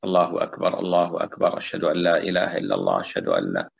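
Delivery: quick, unbroken run of phrases; rapid iqama. Shown as a demonstration. Teacher demonstrating the swift iqama to students, quick and unbroken. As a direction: rattle it off without pausing. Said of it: "أما الإقامة فيحدر".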